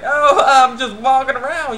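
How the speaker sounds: high, loud, drawn-out cover-up; feigned nonchalance. Retold or imitated. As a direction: play it overly casual, half laughing. Boy caught wandering near her place.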